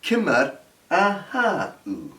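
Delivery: "Kimmer ahau" spoken slowly, stress on ahau, falling tune; slow, careful teacher model of "Kimmer ahau"; slow Gaelic demo. Shown as a demonstration.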